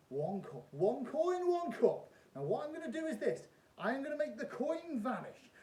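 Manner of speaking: loud, high showman patter; kids party magician. Acted. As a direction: play it big for the crowd. Performs trick as stage magician.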